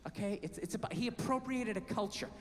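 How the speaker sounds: imitating wife. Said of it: "She goes".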